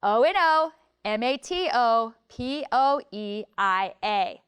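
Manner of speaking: each letter said on its own, slow; spelled out letter by letter. Shown as a demonstration. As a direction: spell it out slowly, one letter at a time. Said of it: "So let's check it".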